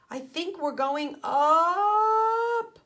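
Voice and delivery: uneasy rising voice. A narrator's reading. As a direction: let it float up in alarm. Ralphie floating upward.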